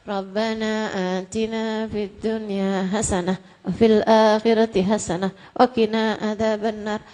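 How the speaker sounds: low toneless rote recitation; half-hearted recited prayer. Shown as a demonstration. Preacher showing listeners an unserious prayer, low and listless. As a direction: mumble a memorised prayer with no feeling. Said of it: "Kalau kamu doanya".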